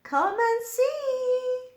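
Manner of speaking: high, loud little bird call; high bird voice calling a name. A narrator's reading. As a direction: call out like a tiny bird from the wall. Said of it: "he called".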